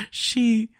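words breaking into helpless laughter; laughing too hard. Real, not acted. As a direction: try to speak through a laughing fit. Can't tell story for laughing.

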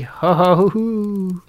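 loud, high hooting cackle; wicked ho-ho laugh. A narrator's reading. Wicked fairy ends her curse, high hooting laugh. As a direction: hoot with gloating crone laughter. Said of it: "she had laughed ho ho and hoo hoo".

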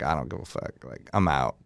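low, very soft shrug-off; quiet, offhand walk-out line; dismissive. Retold or imitated. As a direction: imitate someone too fed up to care. Imitating DJ walking off uncaring.

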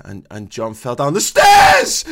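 exclaiming shout. Shown as a demonstration. Showing the co-host how an exclamation sounds, slow loud shout. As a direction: belt it out as an exclamation. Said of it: "meant to be exclaiming".

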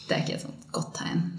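hushed whisper, voice dropped away; whispered thought. Retold or imitated. Telling a friend her private worry, whispered. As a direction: breathe it like a worried private thought. Inner thought about the crying girls.

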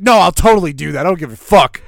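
defiant quote. Retold or imitated. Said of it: "He's like".

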